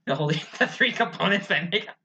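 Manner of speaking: ordinary talk, nothing marked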